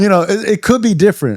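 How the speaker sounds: laughing while talking; high, loud, laughing it off. Real, not acted. Laughing about AA versus NA.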